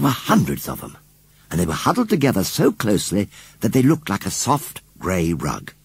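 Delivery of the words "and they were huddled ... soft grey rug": ordinary delivery, nothing marked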